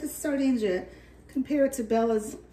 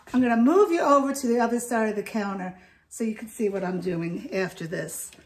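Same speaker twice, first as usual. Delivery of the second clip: ordinary talk, nothing marked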